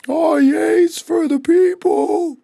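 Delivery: high, loud, drawn-out mock cheer; hammed-up adoring shout, very slow. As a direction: whoop it up like a gullible fan. Mocking [name]'s gullible, cheering fans.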